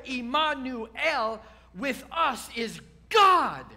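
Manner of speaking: "Emmanuel, with us is God" drawn out slowly, "God" shouted; slow build to a loud cry on "God"; proclaimed slowly, loud on God. Shown as a demonstration. Pastor to congregation, proclaiming slowly with a loud cry on "God". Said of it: "So when we say".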